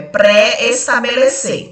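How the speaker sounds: "pré-estabelecer" slowly, with pré and estabelecer kept distinct; both E's sounded. Shown as a demonstration. Teacher confirming the spelling of "pré-estabelecer", slowly sounding both E's. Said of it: "Tem dois S mesmo".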